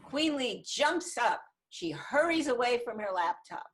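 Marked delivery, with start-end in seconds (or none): none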